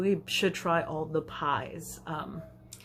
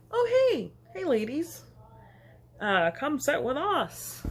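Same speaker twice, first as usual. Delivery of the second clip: friendly dad; 7 semitones higher than usual. Retold or imitated. Dad inviting two women to his table, cheery. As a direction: wave the ladies over warmly.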